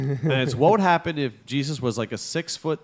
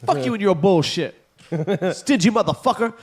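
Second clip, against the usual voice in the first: high, loud outraged cursing; angry bum; 7 semitones higher, 3 dB louder than usual. Retold or imitated. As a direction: spit it at a stingy Jesus. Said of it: "He's like".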